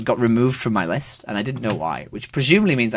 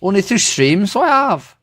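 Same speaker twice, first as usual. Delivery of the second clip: lilting put-on Irish accent; 5 semitones higher, 3 dB louder, slower than usual. Streamer asks a friend if it's weird, Irish accent. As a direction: chat teasingly in an Irish accent. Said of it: "I've never talked to you in Irish accent".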